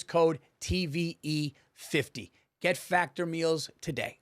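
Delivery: slow, clear ad read of the promo code. Acted. Host reading the sponsor code to listeners, slow and clear.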